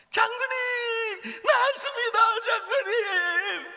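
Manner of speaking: high, loud crying shout of joy; tearful joyful cry. Acted. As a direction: cry out overjoyed, voice breaking. Servant cries out, the general is healed. (울먹이며 기쁘게 외침)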